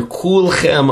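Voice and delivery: Kulchem said high and leaned on, falling at the end; stress on Kulchem. Shown as a demonstration. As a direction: hit Kulchem hard and let it fall. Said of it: "Emphasis on the word Kulchem".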